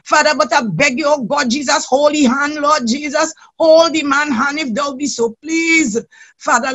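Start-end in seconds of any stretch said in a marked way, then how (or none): none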